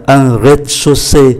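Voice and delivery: rez-de-chaussée run together at natural speed; brisk, joined-up rez-de-chaussée; quick reading. Shown as a demonstration. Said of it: "Đọc nhanh là".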